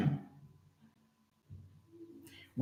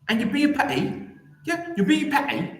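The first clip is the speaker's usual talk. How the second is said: put-on posh accent; 12 semitones higher than usual. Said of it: "You like that posh accent".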